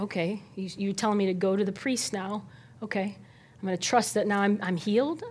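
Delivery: soft, unsure self-talk; a leper talking himself into trust; hesitant inner voice. Retold or imitated. Leper doubting yet trusting Jesus, soft hesitant self-talk. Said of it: "They had to".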